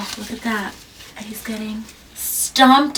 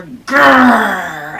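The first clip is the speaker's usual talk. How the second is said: growl; 12 dB louder than usual. Acted. Growling the word grrr.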